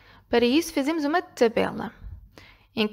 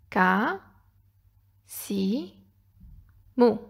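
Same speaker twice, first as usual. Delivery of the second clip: one syllable, then a long pause; spaced out for filling columns; isolated syllables; 2 semitones lower, much slower than usual. Shown as a demonstration. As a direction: dictate each syllable separately. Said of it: "Preenchemos cada coluna com as sílabas da palavra".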